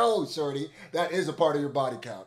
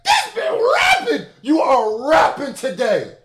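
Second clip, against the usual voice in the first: loud, much higher hype shouts; hyped shout; 11 semitones higher, 11 dB louder, much slower than usual. Real, not acted. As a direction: yell it out, blown away by the rapping. Hyped by the verse.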